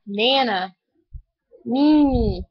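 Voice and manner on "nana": ordinary talk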